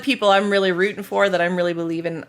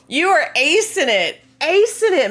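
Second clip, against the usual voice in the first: cheering praise; 8 semitones higher, 5 dB louder than usual. Real, not acted. Cheering someone on.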